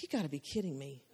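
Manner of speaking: a shocked aside, barely voiced; stunned disbelief. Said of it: "And I was like".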